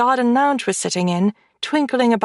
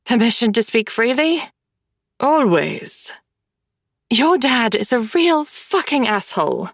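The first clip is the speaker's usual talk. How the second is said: ordinary delivery, nothing marked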